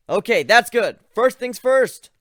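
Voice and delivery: shouting to Ivan at the tree line; practically yelling. A narrator's reading. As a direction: yell so he hears you far off. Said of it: "I had to practically yell".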